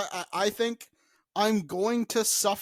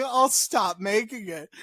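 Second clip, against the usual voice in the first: ordinary talk, nothing marked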